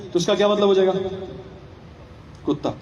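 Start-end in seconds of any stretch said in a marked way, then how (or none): none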